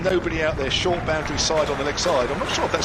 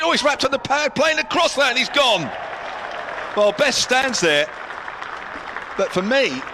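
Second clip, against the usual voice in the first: excited lbw call; 10 semitones higher than usual. Real, not acted. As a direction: react to the wicket with a rush. Lbw appeal, wicket.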